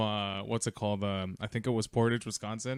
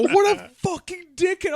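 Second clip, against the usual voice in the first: much higher, louder imitation of a yell; retold yell; 7 dB louder than usual. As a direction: imitate his outburst through your laughter, pitched way up. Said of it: "he just yells out".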